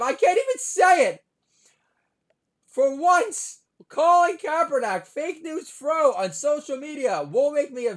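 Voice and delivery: high, strained, stuck on the word; hammed-up flustered rant; mock meltdown. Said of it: "I'm stuttering".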